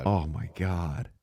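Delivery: slow, drawn-out oh my God; amazed and slow; stunned. Real, not acted. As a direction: let it sink in slowly. Shocked echo.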